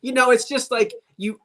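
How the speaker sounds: usual speaking voice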